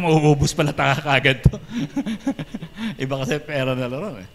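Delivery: laughing through the words. Retold or imitated. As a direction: chuckle while quoting yourself. Laughing at his own story.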